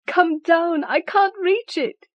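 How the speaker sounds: high, strained, slow panting call. Acted. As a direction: call down while straining upward. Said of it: "strained and panting".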